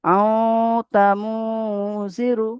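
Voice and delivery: আও তামু জিরু with the nasal hum held on আও; nasal ghunna recitation. Shown as a demonstration. Teacher demonstrating ikhfa to the class, nasal chant. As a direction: chant আও through the nose, then তামু জিরু. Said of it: "নাকে তুলে একারে পরিমাণ গুণনা করে পড়তে হবে".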